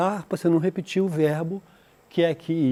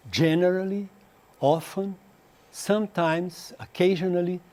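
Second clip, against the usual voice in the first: ordinary talk, nothing marked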